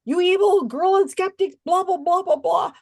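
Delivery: shrieking mock insult, hammed-up; nasty accuser. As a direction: caricature a ranting troll. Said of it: "very ugly words".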